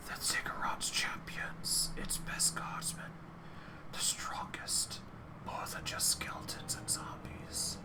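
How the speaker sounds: soft whispering chorus of skulls. Acted. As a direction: breathe every word as a whisper. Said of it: "their whispers blending together in a chorus".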